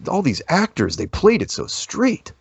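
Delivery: high-pitched imitation of astonishment; amazed friend. Friend marveling at the film's supposed actors, amazed. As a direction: voice climbing high in retold disbelief.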